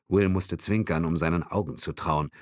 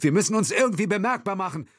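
high, fast, urgent exclamation; urgent call; 3 dB louder, faster than usual. Acted. Will urging action in the kidnapped car, calling urgently. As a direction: urge them on quickly, voice raised in pitch. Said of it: "rief er".

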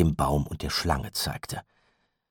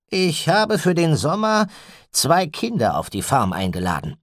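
raised, louder man's voice; Gideon's voice; 11 semitones higher, 6 dB louder than usual. A narrator's reading. Gideon tells Walkwell and the mother, firmly. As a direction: Gideon announcing his decision firmly. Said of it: "sagte Gideon gerade".

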